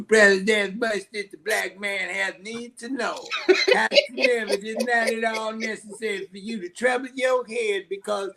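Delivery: high, grandiose mock Jamaican accent. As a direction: ham up a self-important leader in a Jamaican accent. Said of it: "he had a Jamaican accent".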